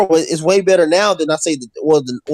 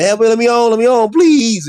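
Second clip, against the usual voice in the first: pleading, louder and higher; mock desperate screaming; 6 semitones higher, 4 dB louder than usual. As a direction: beg to get on the plane, yelling. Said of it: "these losers will be screaming".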